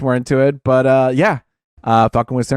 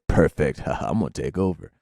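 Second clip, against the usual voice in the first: villain voice; 11 semitones lower, 8 dB quieter than usual. Retold or imitated. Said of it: "and she's like".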